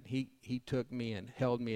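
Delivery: ordinary talk, nothing marked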